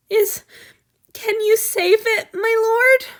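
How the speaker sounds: high, loud desperate plea; pleading. Acted. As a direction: beg the lord to save him. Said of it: "Han Yu pleaded".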